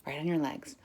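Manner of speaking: ordinary talk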